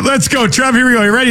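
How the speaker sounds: usual speaking voice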